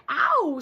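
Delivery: a hammed-up yelp of pain; mock ow. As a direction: yelp like a hurt kid. Walrus child hurt tusk.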